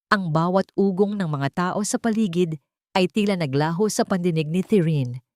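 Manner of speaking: ordinary delivery, nothing marked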